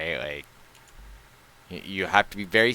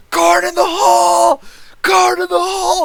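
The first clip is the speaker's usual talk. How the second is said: much higher, much louder frantic scream; 9 dB louder than usual. Retold or imitated. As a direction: scream it like a panicked alarm. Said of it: "started screaming".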